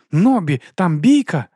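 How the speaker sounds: loud, high alarm call; startled cry. A narrator's reading. Carrot alerts Nobby to a fight, startled shout. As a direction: shout a sudden warning to a partner. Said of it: "скинувся Морква".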